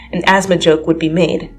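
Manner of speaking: plain reading voice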